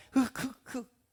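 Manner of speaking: short, staccato fake sobs; badly faked crying in little bursts; mock sobbing. Preacher imitating believers mourning the cross, mock sobs. Acting out grief at crucifixion.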